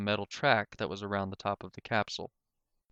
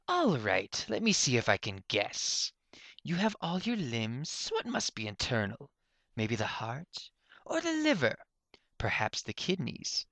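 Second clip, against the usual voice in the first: a hint of a teasing inventor, some lines higher; lightly voiced mocking professor; 4 semitones higher than usual. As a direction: toy with her while guessing.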